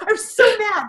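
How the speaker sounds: high, loud exclamation with a laugh in it; mock-angry yell. Mother reliving her scolding of her son, laughing yell. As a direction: blurt out your outrage, laughing through it. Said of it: "like, yelling at him".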